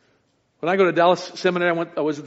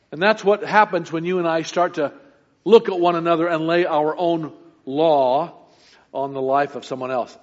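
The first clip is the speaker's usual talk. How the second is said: ordinary talk, nothing marked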